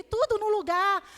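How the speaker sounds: loud, high, triumphant retelling of the verdict; emphatic good news. Retold or imitated. Doctor telling mother the baby is fine, retold triumphantly. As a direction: proclaim it at full volume.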